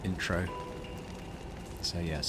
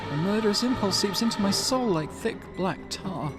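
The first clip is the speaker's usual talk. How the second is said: much higher-pitched character voice; possessed character; 11 semitones higher than usual. Acted. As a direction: utter a dark confession high and strained. Game character possessed by murderous impulse.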